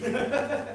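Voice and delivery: a burst of high, breathy laughter; a short high laugh, almost a squeak. Real, not acted. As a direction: laugh at how absurd it was. Laughing at the absurd memory.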